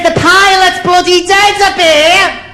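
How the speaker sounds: very high, drawn-out mock panic; panicked cry. Terrified passenger telling air traffic control, in panic. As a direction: wail it up high over the radio. Novice reports dead pilot by radio.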